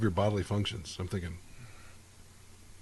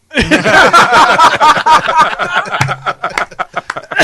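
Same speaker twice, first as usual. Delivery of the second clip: loud high burst of laughter; big laugh; 14 dB louder than usual. Real, not acted. Friend cracking up loudly at the drunk joke. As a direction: roar with laughter at the punchline.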